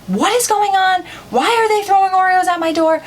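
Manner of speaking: high-pitched mock freaking-out mom; imitated freak-out. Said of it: "my mom freaked out".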